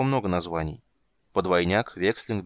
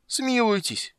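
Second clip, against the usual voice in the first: high-pitched desperate cry for mercy; heart-rending wail; 14 semitones higher, much slower than usual. A narrator's reading. Captured doppler begs for mercy, heart-rending wail. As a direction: wail it far above the usual voice. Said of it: "душераздирающе завопил Доплер".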